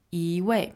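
一 rising smoothly, then 位 falling; softened tone flow. Shown as a demonstration. Teacher models natural 一位, 一 rising into falling 位. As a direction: let 一 glide up into 位. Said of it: "we kind of soften the flow".

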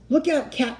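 high-pitched warning; high, urgent call; alarmed cry. A narrator's reading. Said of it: "he cried".

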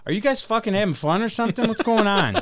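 mock a baffled coworker poking his head in; imitating John. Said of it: "he's like".

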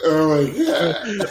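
high, slow, hammed-up wail; milk a tiny cold like a tragedy; overdramatic sick. Acting overdramatic sick man.

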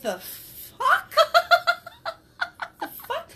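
high, slow, stunned "what the fuck"; shocked and laughing at the coin trick; shocked laughing disbelief. Retold or imitated. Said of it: "And I'm like".

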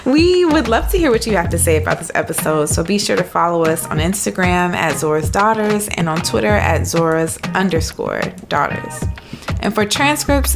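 normal talking voice

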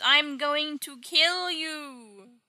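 long, drawn-out high battle cry; hammed-up murderous yell, stretched right out; mock scream.

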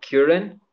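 "Curran" said as KYOO-ran, slowly; "Curran" as KYOO-ran, rising in doubt; questioned pronunciation. Shown as a demonstration. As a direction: try "Curran" with a y glide, questioning. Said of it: "how do you say his last name?".

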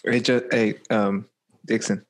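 normal talking voice

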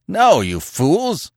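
high, slow, exasperated shout; exasperated roar. Acted. Conan answers the old chief, roaring in exasperation. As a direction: roar it at a pack of fools. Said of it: "roared the exasperated Cimmerian".

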